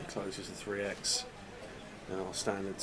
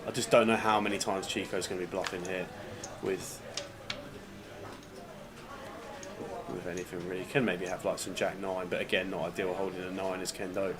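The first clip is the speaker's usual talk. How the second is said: hushed poker commentary; 5 dB quieter than usual. Acted. Quiet play-by-play commentary.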